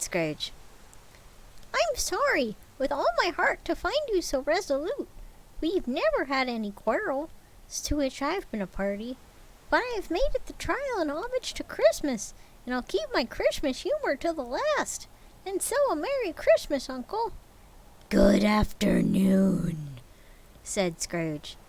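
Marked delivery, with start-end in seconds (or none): 1.7-17.3 high, cracking plea; pleading nephew. Acted. 18.1-20.0 drawn-out final goodbye; slow cold dismissal. Acted.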